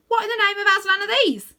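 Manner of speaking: high, loud animal voice; a puzzled beaver squeaks it out. A narrator's reading. He-beaver speaks.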